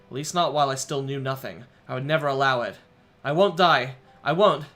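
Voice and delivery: rising to a loud determined shout; defiant vow. Acted. Boy vows to survive whatever it takes, loud defiant shout.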